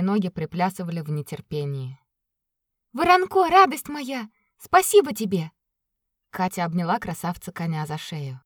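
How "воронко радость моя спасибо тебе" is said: joyful greeting. Acted. Katya greets the horse.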